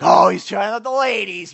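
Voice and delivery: loud, sneering mock of the crowd; mocking judgy listener. Pastor mocks the judging congregation, loud sneer. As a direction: throw their gossip back at them, loud and snide. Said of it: "some of you were like".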